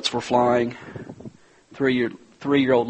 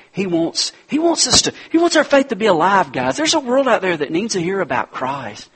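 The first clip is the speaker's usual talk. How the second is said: louder and higher, pushing toward a climax; rising preaching; 8 semitones higher, 5 dB louder than usual. Acted. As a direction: preach it with growing force, faster at first, then slowing right down.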